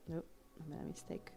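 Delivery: quick whisper to herself; hushed mutter under the breath; whispered aside. Real, not acted. Artist catching a drawing mistake, whispering to herself.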